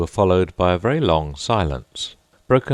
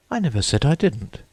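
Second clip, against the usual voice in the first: put-on high girlish protest; Alice interrupting; 9 semitones higher than usual. A narrator's reading. Alice cutting in on the Mock Turtle, high and protesting. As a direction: pitch up, quick indignant girl. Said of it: "interrupted Alice".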